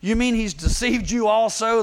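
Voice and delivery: acted outrage at being let down; preacher voicing indignant officials; mad. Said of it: "The Pharisees get mad".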